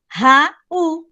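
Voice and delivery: "Raul" split into Ra, ul with a gap; syllables separated. Shown as a demonstration. Teacher proving to students the word has a hiatus, syllables separated. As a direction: break "Raul" apart, each syllable on its own. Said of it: "eu tenho hiato".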